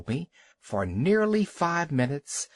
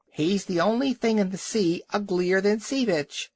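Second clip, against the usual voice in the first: gull's scream; 7 semitones higher than usual. A narrator's reading. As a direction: screech it like a gull wheeling overhead. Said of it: "screamed a Burgomaster gull".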